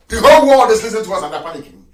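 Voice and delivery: loud, high, impassioned denunciation; full-force preaching. Real, not acted. Broadcaster shaming those who sold Africans, loud fiery preaching. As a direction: thunder it out to the crowd.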